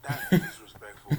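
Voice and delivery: laughing protest. Real, not acted. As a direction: burst out laughing in mock offense. Mock offense after accent tease.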